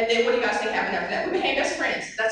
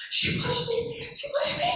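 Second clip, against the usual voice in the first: put-on much higher, childlike complaint; scared child voice; 14 semitones higher than usual. Girl telling her mother she was pushed, high childlike whine. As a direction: whine like a frightened nine-year-old.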